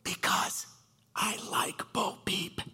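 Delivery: put-on slow, breath-only little-boy confession; imitated child whisper. Son confessing his crush to Dad, whispering. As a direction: whisper it slowly like a shy secret. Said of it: "And he whispers back".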